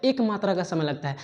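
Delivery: ordinary talk, nothing marked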